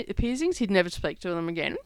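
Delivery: ordinary delivery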